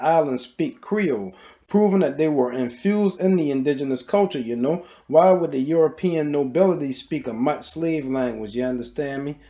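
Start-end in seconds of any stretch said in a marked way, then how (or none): none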